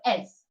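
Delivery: "S" said as one syllable, "ess"; "S" cut to plain "ess"; English letter name. Shown as a demonstration. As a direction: say "S" the English way, no vowel after it. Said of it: "You can't just say".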